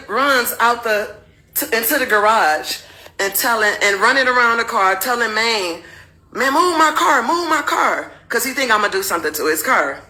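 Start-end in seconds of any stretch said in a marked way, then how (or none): 6.3-8.1 higher-pitched, pressing repetition; mock alarm; retold urgent plea. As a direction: imitate a husband urging a friend to hurry. Husband urgently calling to friend.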